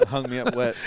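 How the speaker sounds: amused, laugh-filled reply; real laughter. Real, not acted. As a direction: crack up while joking back. Joking along as both laugh.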